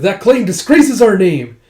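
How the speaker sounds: proud warrior defending his clan's honor, loud and slow; indignant. Retold or imitated. Indignant retort to an insult.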